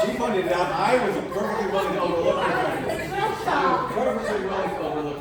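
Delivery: loud laughing mock indignation. Real, not acted. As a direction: laugh through a mock complaint. Laughing over latecomer joke.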